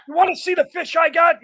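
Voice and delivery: eager quote. Retold or imitated.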